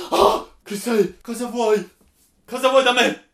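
high, loud, horrified cry of a knight waking from a nightmare; startled shout. Acted. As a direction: yell it out as if fleeing a demon. Rinaldo wakes to Angelica.

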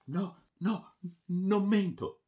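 high, halting plea; strained denial. A narrator's reading. Friar grabbed by the throat, strained denial. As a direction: force out a desperate denial. Friar denies while being throttled.

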